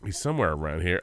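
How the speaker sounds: ordinary talk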